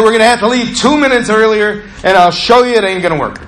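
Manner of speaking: high, insistent challenge; defiant. Retold or imitated. Driver telling the ride-along they'll leave earlier, insistent and defiant. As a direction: announce it like a stubborn dare.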